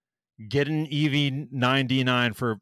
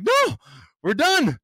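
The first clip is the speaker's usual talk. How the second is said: much higher, louder mock yell; retold outburst; 5 dB louder than usual. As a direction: throw a hammed-up fit of outrage. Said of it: "And I'm like".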